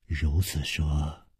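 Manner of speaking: low, quiet, drawn-out words; musing. A narrator's reading. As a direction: say it slowly, half to yourself. 角色沉吟着接话. (低声沉吟，拖慢语速)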